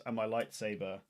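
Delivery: normal talking voice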